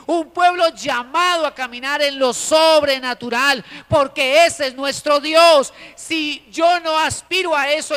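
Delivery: loud, emphatic pulpit delivery with stretched key words; forceful preaching. Acted. Preacher proclaims a supernatural God to the congregation, full-force preaching.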